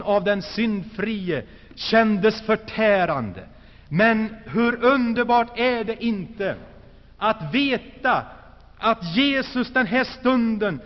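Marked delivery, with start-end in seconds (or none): none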